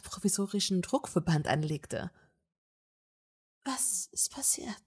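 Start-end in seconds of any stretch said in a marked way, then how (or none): 3.6-4.8 breathless barely audible whisper. A narrator's reading. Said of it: "fragte die Brünette kaum hörbar".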